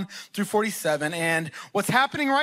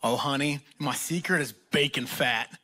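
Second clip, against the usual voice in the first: put-on lower, softer sweet older church lady; lightly voiced doting church cook; a hint of a sweet southern auntie sharing a secret; 7 semitones lower, 4 dB quieter than usual. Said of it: "And she's like".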